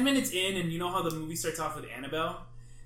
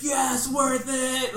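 slow, higher, drawn-out victory shout; triumphant shout; 8 semitones higher, 8 dB louder, much slower than usual. Retold or imitated. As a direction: gloat it out after the prank landed. Triumph after the prank.

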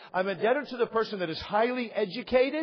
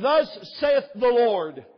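slow preaching declaration; 5 semitones higher, 6 dB louder, much slower than usual. Acted. Preacher declaring God's word.

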